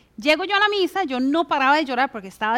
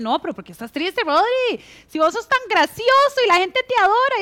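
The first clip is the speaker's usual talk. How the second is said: higher and louder, brushing the sadness off; mock dismissive friend; 6 semitones higher, 4 dB louder than usual. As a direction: wave away his sadness cheerfully. Mocking a friend's dismissive reply.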